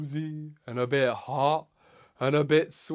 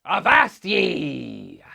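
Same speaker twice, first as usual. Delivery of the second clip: loud, slow pirate call; 8 dB louder, much slower than usual. Pretend. Pirate introducing a word to children, long loud call. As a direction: holler avast ye, drawn out.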